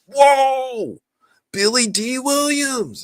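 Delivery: very high, drawn-out awe; gasp-worthy star sighting, hammed up high and loud; mock amazement.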